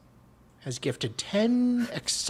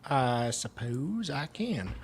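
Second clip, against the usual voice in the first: normal talking voice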